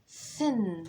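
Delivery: "انا بقول سن" said very slowly; teacher slowing down to model a word; drawn out. Shown as a demonstration. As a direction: stretch "سن" slowly. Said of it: "انا بقول".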